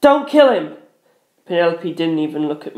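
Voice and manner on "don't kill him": high, pressed warning; urgent strained warning; strained plea. A narrator's reading. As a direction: force out a desperate plea. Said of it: "forcing myself to speak".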